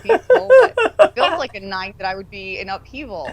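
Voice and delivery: high, loud voice breaking into laughter; laughing hard while imitating someone puzzled. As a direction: push the imagined quote out through laughter. Joking mock quote through laughter.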